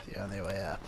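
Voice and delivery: ordinary talk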